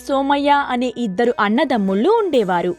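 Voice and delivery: plain reading voice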